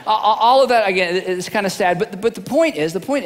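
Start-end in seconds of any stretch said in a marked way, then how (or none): none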